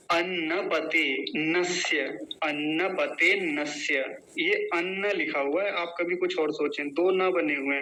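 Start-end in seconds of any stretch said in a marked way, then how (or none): 0.1-3.9 अन्नपते नस्य drawn out slowly, syllable by syllable; pronunciation demo of अन्नपते नस्य. Shown as a demonstration. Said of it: "एक बार उच्चारण देख लीजिए". (अन्नपते नस्य का धीमा शुद्ध उच्चारण)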